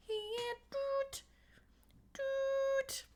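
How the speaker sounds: high tooting trumpet noise; toy trumpet doots. Pretend. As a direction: toot like a tiny trumpet. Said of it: "I have a little trumpet".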